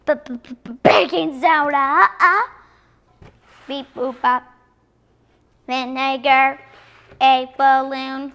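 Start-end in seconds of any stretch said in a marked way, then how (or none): 3.7-4.4 beep-boop robot noises. Pretend. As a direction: play a little robot going beep boop. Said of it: "safety goggles for a robot".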